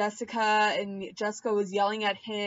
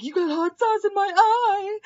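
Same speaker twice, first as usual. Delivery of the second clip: high, loud mock whine; hissy fit; 11 semitones higher, 7 dB louder than usual. Josh having a hissy fit about hot sauce, high loud mock whine. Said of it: "He's like".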